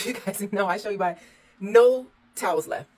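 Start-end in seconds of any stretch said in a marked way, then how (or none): 1.6-2.9 much louder, slow indignant complaint; loud exasperation. Real, not acted. Host showing viewers there are no towels left, slow loud indignant complaint. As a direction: spell out the outrage slowly.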